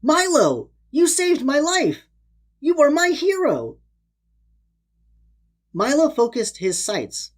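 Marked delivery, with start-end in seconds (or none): 0.0-3.8 higher, sweet grateful little voice; a lightly voiced little turtle, sweet and grateful. A narrator's reading. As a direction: thank him like a small sweet creature. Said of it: "came a sweet reply from the little turtle".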